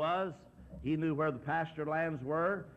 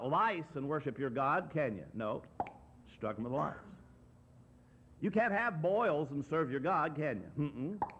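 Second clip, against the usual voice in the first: normal talking voice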